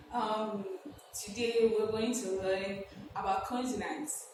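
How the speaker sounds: normal talking voice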